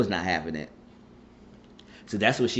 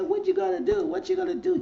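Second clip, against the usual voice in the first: high-pitched mock taunt; retold taunt, pitched way up; faster than usual. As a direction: goad him in a high, needling voice. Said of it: "Keep taunting him".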